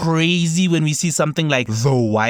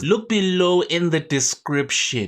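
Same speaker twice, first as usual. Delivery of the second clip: ordinary delivery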